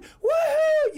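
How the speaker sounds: loud high whoop like a cheering crowd; cheering shout. Retold or imitated. Imitating the crowd cheering him at the finish, loud high whoop. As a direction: whoop it up like fans at a finish line.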